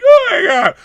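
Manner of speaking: high loud squeal; mock shriek. Mocking over-the-top movie whining, high squeal. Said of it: "It's not like".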